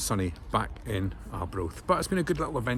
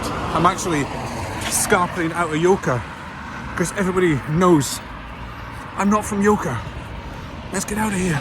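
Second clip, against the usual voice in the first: mock panic; 7 semitones higher, 4 dB louder than usual. Acting out Dee Dee's scared escape.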